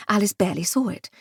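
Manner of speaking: plain reading voice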